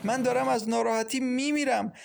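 high-pitched, distraught lament; tearful. A narrator's reading. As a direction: voice despair as if crying. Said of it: "و بعد با گریه گفت".

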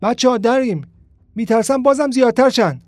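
high, loud panicked yell; scared boy yelling over the brawl; frightened shout. Acted. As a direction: shout a warning to your friends. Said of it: "فریاد زد".

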